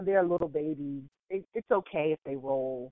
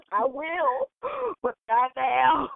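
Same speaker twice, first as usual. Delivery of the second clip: slow, tearful but not now; half-crying refusal; mock weepy; 7 semitones higher, 3 dB louder, much slower than usual. Exhausted retold reply.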